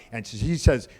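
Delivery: normal talking voice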